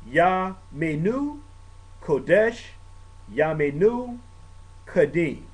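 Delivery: slow Hebrew liturgy, words spaced apart; measured liturgical Hebrew; Hebrew prayer. Shown as a demonstration. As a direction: carefully read out the closing Hebrew words. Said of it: "I'm gonna read this in Hebrew".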